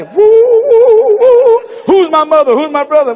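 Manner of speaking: high, loud eerie wooing; hammed-up crazy man calling out; spaced-out madman. Mocking Jesus as seemingly out of it.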